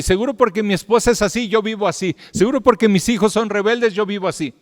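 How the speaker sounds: high, loud fervent preaching. Acted. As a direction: preach it out to the whole church with full force. Preaching, voicing excuses.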